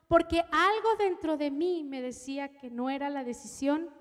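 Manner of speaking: usual speaking voice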